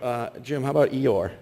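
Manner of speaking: ordinary talk, nothing marked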